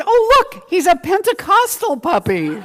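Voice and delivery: high, loud gushing squeal; wife delighting in the puppy, loud high squeal; excited exclamation. Retold or imitated. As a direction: squeal it like the wife can't believe her eyes. Wife's punchline in joke.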